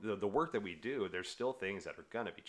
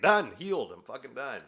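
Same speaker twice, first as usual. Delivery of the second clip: loud, high mock proclamation; mock triumph; 7 semitones higher, 12 dB louder than usual. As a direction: announce it like a grand victory. Acts out claiming to be healed.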